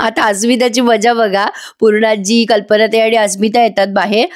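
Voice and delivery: usual speaking voice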